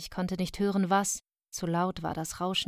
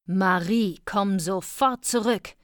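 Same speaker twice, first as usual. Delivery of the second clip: loud, stern order; stern call; 2 semitones higher, 6 dB louder, slower than usual. A narrator's reading. Mother calling her daughter back over the fence, stern. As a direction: call the child back sharply and loudly. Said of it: "Der Ton war gar nicht gut".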